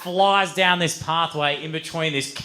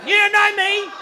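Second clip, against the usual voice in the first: high-pitched furious yell; sudden shouted outburst; loud outburst; 5 dB louder than usual. Retold or imitated. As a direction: erupt at your bags, loud and high. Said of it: "after that loud outburst".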